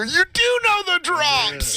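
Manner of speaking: slow, high yelled line; high slow mock yell; high mock shout.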